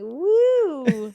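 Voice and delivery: high, loud drawn-out "woo"; long gleeful mock whoop, swooping up and down; flying whoop. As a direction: whoop as if soaring through the sky. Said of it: "and I'm like".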